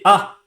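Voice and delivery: sharp loud ah of shock; jump-scare yell; startled cry. Real, not acted. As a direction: yelp as the floor gives way. Floor opens in game.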